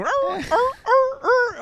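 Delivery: very high, loud imitated barks; dog barking. Dog going off outside the room, imitated high barking. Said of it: "and the dog going".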